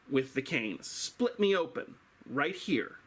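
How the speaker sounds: usual speaking voice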